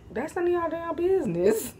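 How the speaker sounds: high-pitched, sing-song mock indignation; playful rebuff. Vlogger dodging a question about underwear, playful rebuff.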